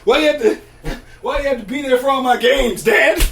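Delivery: loud, high-pitched mock complaint; mock outrage. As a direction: yell at your parents in playful protest. Mock rant at his parents.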